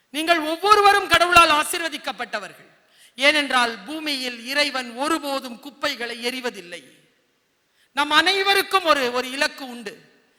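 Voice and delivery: loud, high uplifting preaching; rousing blessing. Acted. Preacher blesses the children, loudly uplifting.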